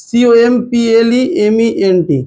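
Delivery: slow letter-by-letter spelling. Shown as a demonstration. Teacher spells complement for the class, slow letter by letter. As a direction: spell it out one letter at a time.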